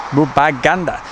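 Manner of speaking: usual speaking voice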